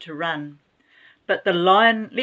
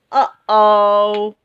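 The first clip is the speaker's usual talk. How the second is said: loud crying uh-oh; alarmed uh-oh; 7 dB louder than usual. A narrator's reading. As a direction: wail it in dismay. Dozer goes into reverse.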